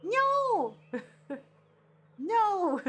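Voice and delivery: high frightened yelp of no; terrified cry. Acted. Player shrieking at the spirit's threat, mock terrified.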